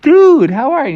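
high, loud, swooping hello; gushing high-pitched surprise; excited greeting. Acted. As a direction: greet a pal with big delight. Characters greet Donald Duck.

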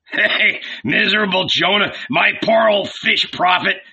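high, jeering amusement; loud, gleeful scoffing; laughing mockery. A narrator's reading. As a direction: tease him with rising glee. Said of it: "burst into laughter".

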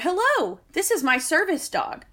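a hint of a prim lady; imitating the lady. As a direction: put on her sweet announcing voice. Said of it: "came in and said".

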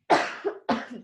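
harsh dry cough; coughing fit. Real, not acted. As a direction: cough hard, unplanned. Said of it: "I'm coughing so much".

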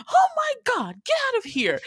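high-pitched squawk of alarm, no real volume; mock yell. Aunt shoos the dog from the baby, high mock yell. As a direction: imitate a panicked aunt shrieking high. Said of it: "because she's yelling".

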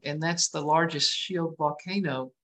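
ordinary talk, nothing marked

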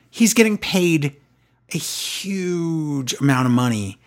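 ordinary talk, nothing marked

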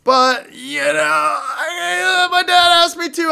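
high, loud half-crying whine; mock whiny sob. To his friends about his dad's request, a mock tearful whine. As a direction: whimper it like a put-upon kid.

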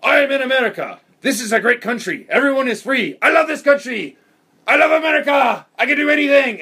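high, loud, proud shouted declaration in a put-on voice; drunk patriotic rant. Quoting the drunk friend at the kegger, high mock-proud shouting. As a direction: proclaim your love for America like a drunk convert. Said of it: "He's like".

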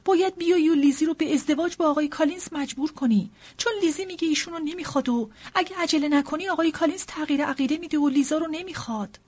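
high, loud, agitated wife; frantic wife raising her voice; loud urgent appeal. Acted. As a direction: call out in alarm for urgent help. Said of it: "با صدای بلند گفت".

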